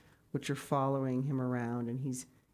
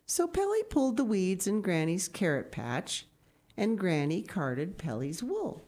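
bright, lifted read-aloud storytelling; storybook narration; 4 semitones higher than usual. A narrator's reading. Reading a picture book aloud to children, lifted storytelling. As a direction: tell it like a bedtime story to the kids.

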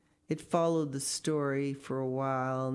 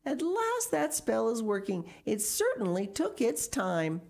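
higher, exasperated relief; crying out; 7 semitones higher than usual. A narrator's reading. Miss Pinchin sees her spell working, crying out. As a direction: exclaim it high over the rain. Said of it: "cried Miss Pinchin".

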